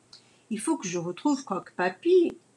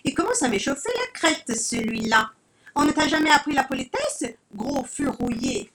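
higher, louder, fast scolding; cross little hen; indignant hen; 7 semitones higher, 3 dB louder than usual. Acted. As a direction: tell the rude rooster off.

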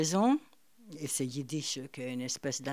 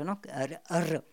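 ordinary talk

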